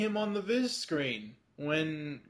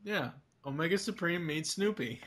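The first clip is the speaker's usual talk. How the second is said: ordinary delivery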